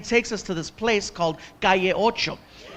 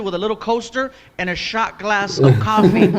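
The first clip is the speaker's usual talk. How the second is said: usual speaking voice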